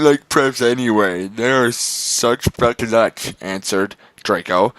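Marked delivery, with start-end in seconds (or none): none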